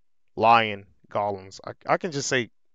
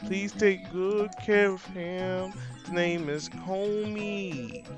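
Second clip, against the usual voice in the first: higher, slow mock sweet voice; high character voice; 7 semitones higher than usual. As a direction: play a sugary character lightly.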